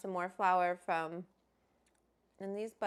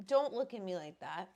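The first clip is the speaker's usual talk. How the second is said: normal talking voice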